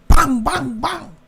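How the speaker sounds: high, punchy knocking imitation; high spoken sound effect; imitated window banging. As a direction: fire off the bangs like fists on glass.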